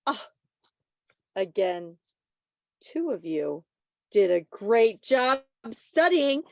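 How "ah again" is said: ordinary talk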